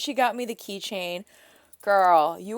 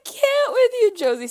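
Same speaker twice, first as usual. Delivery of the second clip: high, loud squeal of delight; excited shriek; 13 semitones higher, 10 dB louder than usual. Real, not acted. Friend shrieking with joy at Josie's gift. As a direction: scream it with pure delight.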